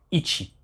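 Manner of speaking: "ichi" said slowly as ee-chee; Japanese pronunciation. Shown as a demonstration. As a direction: model the Japanese word "ichi" for learners. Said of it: "une de ses prononciations en hiragana".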